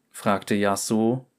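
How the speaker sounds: usual speaking voice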